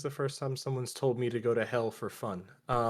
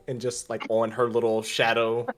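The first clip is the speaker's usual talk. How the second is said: ordinary talk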